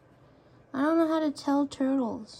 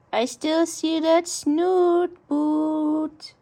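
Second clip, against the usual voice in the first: slow, louder sing-song tease; slow teasing; 5 dB louder, much slower than usual. Real, not acted. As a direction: drawl it out teasing the pet. Playful taunt repeated.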